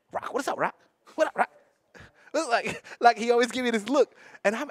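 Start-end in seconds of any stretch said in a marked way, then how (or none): 0.1-1.5 mimicking Ryan. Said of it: "He was like".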